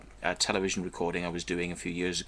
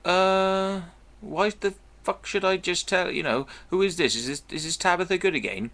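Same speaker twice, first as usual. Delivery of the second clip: exasperated self; 9 semitones higher than usual. Retold or imitated. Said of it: "I'm like".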